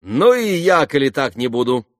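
high, loud teasing voice; tossing it off gaily; young woman siding with Alyosha, lively and high; playful Grushenka. A narrator's reading.

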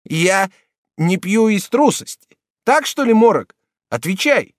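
very high, loud challenge; offended drunk confronting his mocker, angry; angry demand. A narrator's reading. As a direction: demand an answer now. Drunk Jacques challenging Morok.

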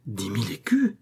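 usual speaking voice

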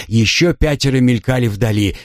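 ordinary delivery, nothing marked

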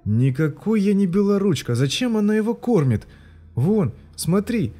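put-on high child's voice, upset and insistent; a small boy making his case; indignant boy. Boy protests to mother, high and indignant. Boy's voice in story.

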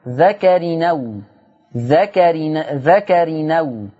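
ذكر نو drawn out slowly, hamza dropped into nun; demonstrating naql. Shown as a demonstration. Teacher showing students how to read ذكر نو slowly. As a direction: stretch ذكر نو so the nun carries the fatha. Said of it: "تبقى نون مفتوحة".

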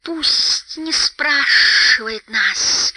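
high, drawn-out creature screech with hisses; high sibilant squeal; shrieking Gollum. A narrator's reading. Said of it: "взвизгнул Голлум".

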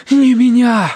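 high, loud, breath-spent protest; gasped cry. A narrator's reading. Terence in the water refuses rescue first, gasped cry. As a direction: gasp it out urgently. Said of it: "выдохнул он".

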